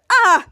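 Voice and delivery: short acted yelp; mock cry of pain. Fake Chalkman has his beard pulled off, loud yelp.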